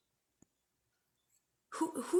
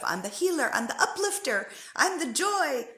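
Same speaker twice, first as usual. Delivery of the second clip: mock cheery healer; 7 semitones higher, 4 dB louder than usual. Mocking healer identity.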